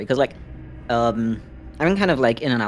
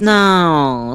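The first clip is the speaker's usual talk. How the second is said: ordinary talk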